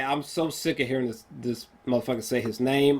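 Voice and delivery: ordinary delivery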